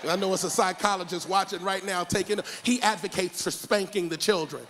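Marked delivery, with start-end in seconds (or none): none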